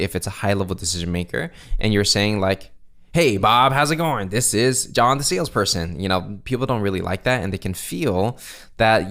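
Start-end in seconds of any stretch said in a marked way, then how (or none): 3.1-6.0 higher, pushy-bright sales greeting; typical salesperson. Shown as a demonstration. Coach mimicking a salesperson calling a decision maker, typical sales voice. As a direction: put on a typical salesperson's pitch. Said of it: "don't sound like a typical salesperson".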